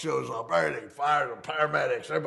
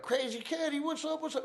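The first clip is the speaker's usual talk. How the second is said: much higher, softer mock kid voice; little girls greeting; 6 dB quieter than usual. Little girls on the stairs greeting Crazy Kenny, high and soft. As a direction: lightly pipe it up like the little girls.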